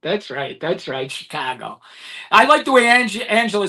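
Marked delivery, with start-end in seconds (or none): 0.0-1.8 quiet aside. Real, not acted. Echoing the city name, teased.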